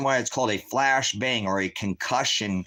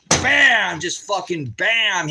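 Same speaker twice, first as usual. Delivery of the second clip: much higher and louder, then a slow punched bam; loud blast cry; 9 semitones higher, 7 dB louder, much slower than usual. Retold or imitated. Man recalling the grenade hitting his face, loud blast cry. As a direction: shout the impact out.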